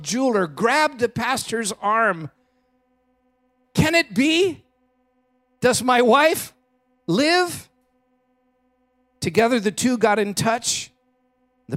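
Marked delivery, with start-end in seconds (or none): 3.7-7.7 high, breathless disbelief; he dares to hope his wife lives; startled hope. Acted.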